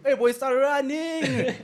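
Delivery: high, loud, drawn-out warning through laughter; mock warning shout. Friend joking about a robber's whistle, mock warning shout. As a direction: yell a hammed-up warning to flee.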